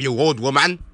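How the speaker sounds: ordinary delivery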